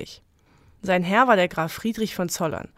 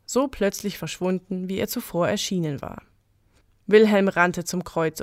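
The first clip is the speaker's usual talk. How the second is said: plain reading voice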